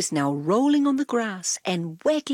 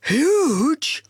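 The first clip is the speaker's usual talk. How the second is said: loud eager dog bark; 7 semitones higher, 6 dB louder than usual. A narrator's reading. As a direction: blurt it out like an excited dog. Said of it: "woofed Dog".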